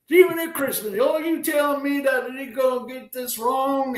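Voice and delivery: imitating an Oklahoma accent. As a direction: do Merce's Oklahoma twang, worked up. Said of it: "in his Oklahoma accent".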